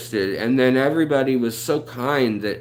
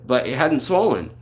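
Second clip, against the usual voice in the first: ordinary talk, nothing marked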